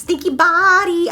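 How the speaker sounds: put-on high, slow, loud baby talk; squeaky pet-owner voice; dog-talk voice. Shown as a demonstration. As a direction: coo stretched-out teasing at a dog. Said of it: "So he adapted it".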